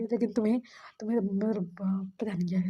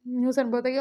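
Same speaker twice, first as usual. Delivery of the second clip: ordinary delivery, nothing marked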